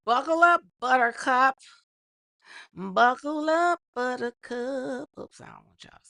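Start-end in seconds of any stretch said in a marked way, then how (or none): 0.1-1.6 punchy, loud rally; loud hype call. Real, not acted. Hypes viewers for story. 2.7-5.1 slow, stretched catchphrase; drawn out. Real, not acted. Repeats catchphrase slowly.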